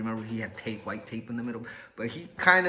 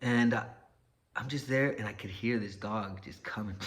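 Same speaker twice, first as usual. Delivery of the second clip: ordinary delivery, nothing marked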